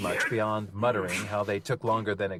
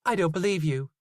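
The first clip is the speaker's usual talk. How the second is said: higher, dry Zoe voice; Zoe, haggling with the butcher; Zoe's voice; 8 semitones higher, 4 dB louder than usual. A narrator's reading. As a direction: brush it off as Zoe.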